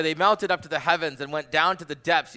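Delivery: loud, high proclaiming voice; dramatic scripture reading. Acted. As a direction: read the psalm like a storm rising. Continues the psalm reading.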